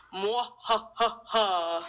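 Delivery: slow villain cackle; evil laugh. Acted. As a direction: drag out a gloating evil laugh. Gloating over the pandemic.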